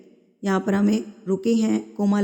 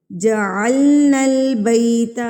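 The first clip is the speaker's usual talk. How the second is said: slow, louder tajweed chant; Quran recitation; 5 dB louder, slower than usual. Shown as a demonstration. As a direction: recite the phrase melodically. Recites the verse. (تلاوت کا انداز)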